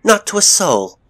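high, loud, urgent protest; crying out. A narrator's reading. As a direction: cry out in your own defence. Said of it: "cried Elizabeth".

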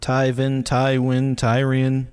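Tyvin, Tywin, Tyrion, each begun like "tie"; matching TIE names. Shown as a demonstration. Host shows the names match, Tyvin, Tywin, Tyrion all with TIE. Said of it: "Why wouldn't it just be".

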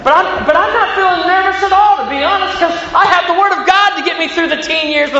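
high-pitched, joking denial of nerves; put-on high voice, protesting too much; mock confidence. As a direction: insist comically that all is fine. Joking denial of nerves about daughters.

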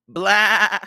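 high loud goat bleat blah; sharp throaty bleat. Acted. Grover bleats in irritation at Percy, sharp throaty bleat. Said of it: "let out a sharp, throaty".

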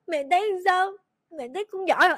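put-on a child sobbing but proud; teary proud child. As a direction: fish for praise through sobs. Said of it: "kiểu vẫn còn khóc nhưng mà kiểu sướng".

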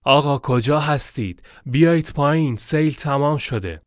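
calling out loudly, pitched high; shouting. A narrator's reading. Guide calls up to the stranded traveller, high shout. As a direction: shout it up to someone out of sight. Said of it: "فریاد زد".

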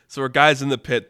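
ordinary talk

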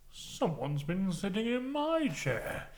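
slow, put-on father bear; Daddy Bear. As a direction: draw it out as a storybook daddy bear. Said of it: "Daddy Bear goes".

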